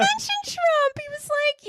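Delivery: very high, squealed through laughter; laughing hard. Real, not acted. Host telling co-host what the quote left out, squealing with laughter. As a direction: barely get it out for laughing.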